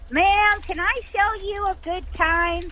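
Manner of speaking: high, pinched voice up in the nose; high nasal come-on; nasal high ghost voice. Retold or imitated. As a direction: squeeze it up into the nose like a sleazy flirt. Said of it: "very up high in his nose, very nasally".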